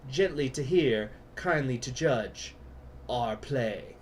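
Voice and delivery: slow, theatrical British Shakespeare declamation; grand British recital. Pretend. General Sablin turning British, reciting the Henry V prologue grandly. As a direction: proclaim it like a British stage chorus. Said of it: "get very British and go".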